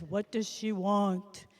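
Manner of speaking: slow, low-ish magic fish; solemn fish, lightly voiced. Deep fish voice.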